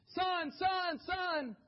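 high, loud shouting for his son; frantic calling. Retold or imitated. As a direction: shout the name in panic. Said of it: "And he's".

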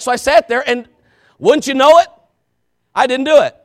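high, loud confession from the pulpit; forceful, high-pitched pulpit delivery; full-force preaching. Real, not acted. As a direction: shout the admission to the congregation.

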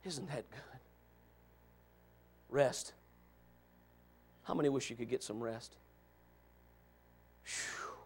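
usual speaking voice

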